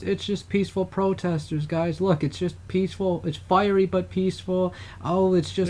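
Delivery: high, sing-song mock media voice. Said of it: "kept trying to play this".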